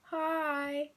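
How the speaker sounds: high, teasing little hi; taking the mick. Retold or imitated. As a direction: tease them with a drawn-up hi. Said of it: "I was taking a mick".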